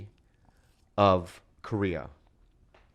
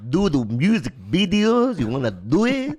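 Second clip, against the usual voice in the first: slow, louder imitated Korean accent, pitched up; 7 semitones higher, 5 dB louder, slower than usual. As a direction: insist in a mock Korean accent. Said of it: "And he goes".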